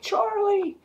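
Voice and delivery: very high cry of his name; high mock wife yelling a name; calling out. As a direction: shout after him as he storms out. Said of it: "Wilma's like".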